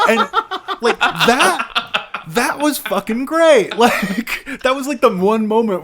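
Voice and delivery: high, loud laughing delight; laughing with glee. Real, not acted. Laughing at grim passage.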